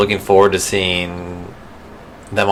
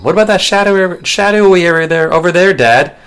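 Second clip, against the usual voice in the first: mock young Simba; 10 semitones higher than usual. Son asking his dad in a mock high child voice. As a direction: pipe up like a curious cub. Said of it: "mocking the scene".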